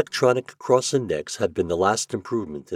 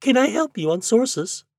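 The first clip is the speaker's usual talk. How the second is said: a much higher, polite woman's voice; human female voice; 4 dB louder than usual. A narrator's reading. Librarian answers Shandor's call, polite female voice. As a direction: sound like a helpful librarian on the line. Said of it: "A female voice, quite human".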